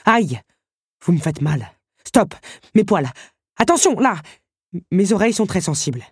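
pained, jumpy protests in bursts; whining complaints. A narrator's reading. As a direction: yelp and grumble at being handled roughly. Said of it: "sans écouter les jérémiades". (jérémiades d'une bête qui a mal)

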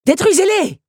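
high, full-throated yell; screamed order. A narrator's reading. Henri orders the puppets to destroy them, screaming himself hoarse. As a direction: yell your throat out commanding. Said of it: "s'égosillait Henri".

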